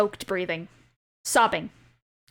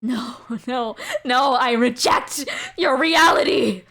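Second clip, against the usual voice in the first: louder, slow, anguished defiance; defiant grief; 5 dB louder than usual. Acted. Father rejecting the spirit's verdict, slow defiant anguish. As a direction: refuse it with everything you have.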